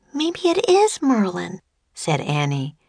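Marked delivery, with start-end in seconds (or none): 0.1-1.6 put-on high-pitched little girl voice; young girl. A narrator's reading. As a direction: pipe up like an eager child. Narrator voices young Annie.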